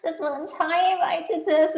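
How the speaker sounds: put-on high, slow Valley Girl voice for a talking cow. Host recalls the talking cheese cows in a Valley Girl voice. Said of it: "talking with kind of Valley Girl intonation".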